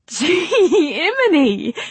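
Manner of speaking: high, loud, giddy squeal; excited exclamation. A narrator's reading. Young woman scrambling aboard the funicular, excited exclamation.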